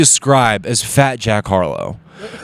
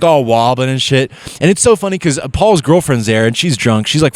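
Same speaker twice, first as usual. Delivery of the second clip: ordinary talk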